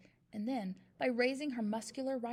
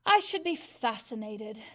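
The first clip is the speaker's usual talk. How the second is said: louder, mock-interested sneer; dripping sarcasm; 8 dB louder than usual. A narrator's reading. Headmistress mocking a child's offer, sarcastic. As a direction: drip sarcasm on every word. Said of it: "in a voice dripping with sarcasm".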